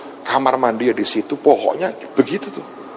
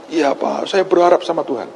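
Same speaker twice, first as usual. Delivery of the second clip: imitated woman caller; 3 semitones higher than usual. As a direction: answer obediently in a lifted woman's voice. Voices the woman's reply to him. (menirukan ibu menjawab dengan suara lebih tinggi)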